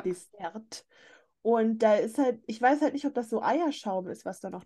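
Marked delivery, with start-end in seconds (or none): 0.0-0.9 whispered under the breath. Shown as a demonstration. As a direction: breathe the word Dessert softly. Repeats word, then whispers it.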